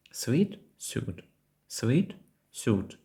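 "Sweet" with w-ee, then "suit" with oo; minimal contrast. Shown as a demonstration. Teacher lets learners hear the difference, contrasted pronunciation. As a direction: alternate "Sweet" and "suit", pausing between them. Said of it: "Ви чули різницю?".

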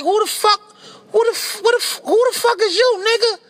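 high, startled shout of alarm; startled yell. Retold or imitated. To the lurking client, a startled high yell. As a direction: jump back yelling at a stranger.

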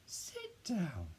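high-pitched, falling at the end. A narrator's reading. Dad telling Beckett to sit down, high squeaky voice. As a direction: pitch it way up, then drop. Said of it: "his voice goes even higher than mine".

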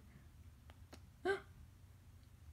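high breathy gasp of shock; sharp whispered gasp. Acted. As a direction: gasp in sudden alarm. Character discovers something.

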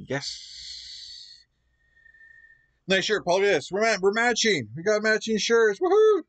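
ordinary talk, nothing marked

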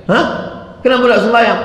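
high imitated challenge; acted incredulous question; astonished questioner. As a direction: ask it high, in disbelief. Challenger questions the man not praying.

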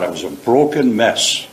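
ordinary talk, nothing marked

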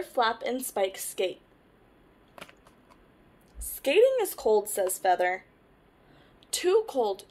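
lively picture-book read-aloud; reading to children. Acted. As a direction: tell it to little ones, brightly.

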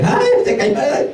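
high, laughing "ay" at someone falling; mock gleeful cry. Preacher acts a mocker laughing at a fallen man, high squeal. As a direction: squeal with glee at the tumble. Imitating someone mocking a fall.

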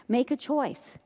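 normal talking voice

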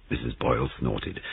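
ordinary delivery, nothing marked

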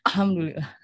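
"Alhamdulillah" as a garbled, front-heavy umbrella; like a sneeze. Shown as a demonstration. Teacher jokes on wrong umbrella stress, sneeze-like "Alhamdulillah". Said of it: "Kayak bersin".

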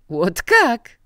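loud, high venomous exclamation. A narrator's reading. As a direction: spit it out with a venomous smile. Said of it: "szepnął, uśmiechając się jadowicie". (jadowity okrzyk)

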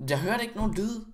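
high, puzzled protest; baffled protest. Real, not acted. Gamer who heard no warning, baffled high protest. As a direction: protest in high-pitched bewilderment. Protests after sudden game death.

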